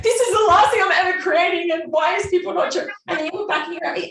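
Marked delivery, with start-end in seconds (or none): 0.0-2.9 loud, high ranting outburst; mock tantrum. Imagining a frustrated launch, loud and high. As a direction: rant in a laughing huff.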